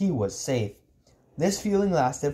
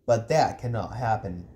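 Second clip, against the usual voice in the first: low, controlled firmness; low, unbending uncle voice; firm refusal; 5 semitones lower than usual. Acted. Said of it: "he said firmly".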